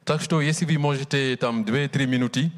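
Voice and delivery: ordinary talk, nothing marked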